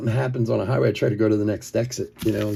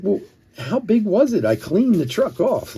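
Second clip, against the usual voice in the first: incredulous; 8 semitones higher than usual. Retold or imitated. Defending himself to the driver.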